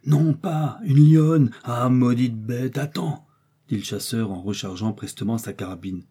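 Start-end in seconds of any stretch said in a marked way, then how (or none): 0.0-3.3 slow, drawn-out raised threat; slow menacing outburst; cursing threat. A narrator's reading. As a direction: drag out the curse at the beast. Hunter curses and threatens the beast.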